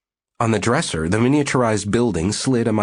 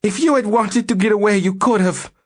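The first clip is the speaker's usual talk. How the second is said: shrill, much higher and louder retort; shrill, sped-up; 13 semitones higher, 4 dB louder than usual. Acted. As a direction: spit it back fast and shrill. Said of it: "shrill, speeded-up voice".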